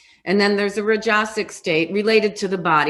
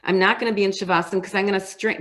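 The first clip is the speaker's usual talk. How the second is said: ordinary talk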